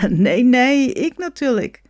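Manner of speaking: loud, high, amused denial; laughing protest. Acted. Tanned man in Spain answers the conference, laughing. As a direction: laugh it out, pitched well up. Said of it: "lachte ein braungebrannter".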